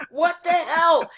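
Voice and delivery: loud, very high-pitched disbelief; high incredulous exclamation. Retold or imitated. Neighbor turning toward the cops, loudly and highly incredulous. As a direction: exclaim in exaggerated bafflement, voice way up.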